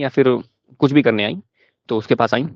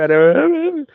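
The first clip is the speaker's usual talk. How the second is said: high wailing cry, hammed-up; mock crying wail; 14 semitones higher, much slower than usual. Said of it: "रोते हुए". (नकली रोते हुए ऊँची आवाज़ में "अरे")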